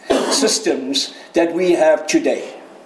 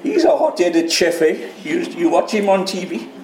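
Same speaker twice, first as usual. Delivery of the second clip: ordinary talk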